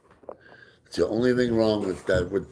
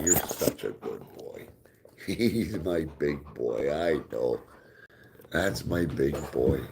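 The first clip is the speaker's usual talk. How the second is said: lower, soft and slow doting praise; cooing at dog; 6 semitones lower, 6 dB quieter than usual. Real, not acted.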